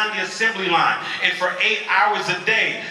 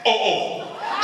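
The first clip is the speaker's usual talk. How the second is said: high Haitian-style "oh, oh" of surprise; surprised, this is bad "oh, oh"; 4 semitones higher than usual. Shown as a demonstration. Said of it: "when Haitian people are surprised, here's what they say".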